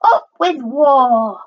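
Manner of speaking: high, drawn-out war cry; shouting. A narrator's reading. Tyrannosaur family calling for war, shouting. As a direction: stretch it into a high rallying cry. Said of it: "And they shouted".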